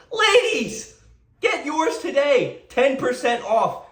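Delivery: high, laughing, hammed-up saleswoman pitch; squealing fake commercial voice, slow on the close; mock ad voice. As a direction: sell it like a cheesy late-night ad, through a laugh. Mocking a sex toy ad under the post.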